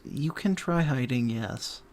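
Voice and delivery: hushed, resigned game master; half-whispered, weary consent; reluctant. Real, not acted. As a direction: give grudging permission under the breath. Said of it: "that's the same voice I hear a lot".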